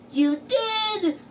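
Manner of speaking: high, loud astonished question; SpongeBob. Acted. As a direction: squeal it in disbelief. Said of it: "asked SpongeBob".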